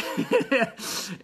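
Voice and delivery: a real chuckle, falling away; brief amused laugh; short laugh. Real, not acted. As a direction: laugh at your own joke. Laughs at his own joke.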